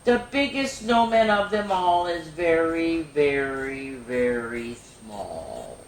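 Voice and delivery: dwindling storybook voice; shrinking read-aloud. Acted. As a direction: let the words shrink away with the snowman. Snowman melting to nothing.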